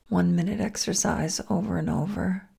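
ordinary talk, nothing marked

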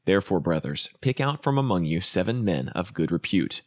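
ordinary talk, nothing marked

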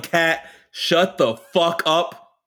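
slow, stretched "Kat, shut the fuck up"; lightly hammed outburst; mock yell. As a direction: drag every word out. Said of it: "If Maddie would have yelled".

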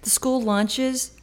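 ordinary delivery

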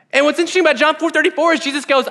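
usual speaking voice

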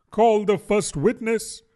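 a pompous little king; a touch of a royal command. Acted.

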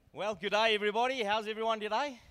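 high, cheery Aussie hello; Australian accent greeting. Shown as a demonstration. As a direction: greet the crowd in Australian style. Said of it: "That accent's not gonna do".

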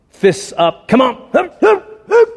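rising high mock boxer goading; taunting fighter. Mimicking a fighter's stance.

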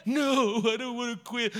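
high tearful mock whine; crying recruit. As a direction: protest through put-on sobs. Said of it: "'cause I'm crying".

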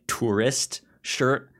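ordinary talk, nothing marked

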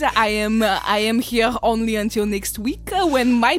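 put-on thick French accent with throaty R's; hesitant, hammed-up French frog chef. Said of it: "is a frog in a French kitchen".

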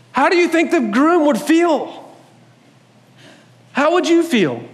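high, slow, pleading question; earnest appeal. Real, not acted. Pastor pressing the congregation to feel the groom's hurt, high and slow. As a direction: ask it slowly, high and earnest. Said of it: "pastor asks congregation".

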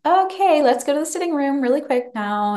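put-on hurried false cheer to the child; forced cheerful. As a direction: hide the fear behind a chirpy tone. Mum reacts to a creepy question.

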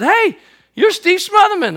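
high, loud, drawn-out hail; hammed-up zookeeper hailing a celebrity; calling out. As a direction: call out in excited recognition, acting the zookeeper. Acting out zookeeper accosting him.

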